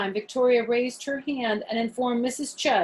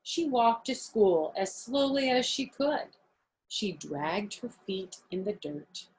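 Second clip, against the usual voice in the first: usual speaking voice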